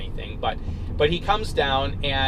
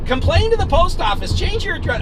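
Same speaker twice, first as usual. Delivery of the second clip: high, loud angry retort; yelling back; 6 dB louder than usual. Retold or imitated. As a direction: fire it back at a yell. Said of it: "we're yelling back and forth".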